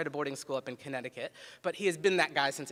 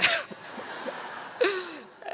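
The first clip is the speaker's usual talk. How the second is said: hearty laugh; 13 semitones higher than usual. Real, not acted. Laughter after the punchline.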